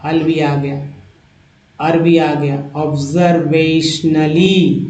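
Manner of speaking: ordinary delivery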